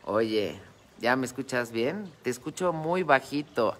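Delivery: normal talking voice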